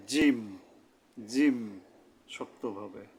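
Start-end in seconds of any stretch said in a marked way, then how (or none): 0.1-1.8 জিম pressed hard, tongue middle against the palate; firm Arabic jim. Shown as a demonstration. Teacher drilling the letter jim for viewers, firm and pressed. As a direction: hit জিম firmly from the palate. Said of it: "তালুর সাথে মিলিয়ে শক্তভাবে".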